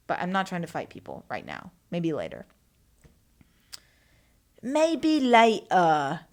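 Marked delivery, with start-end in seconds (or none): 4.6-6.2 hammed-up British accent, slow and loud; badly faked drawn-out British accent. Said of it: "trying to sound like [name]".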